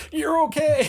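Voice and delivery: high strained squeak of relief; mock pained gasp of relief; Lily's pained relief. Retelling Lily in pain, a high strained relieved squeak. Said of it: "fantastic acting by her 'cause she's like".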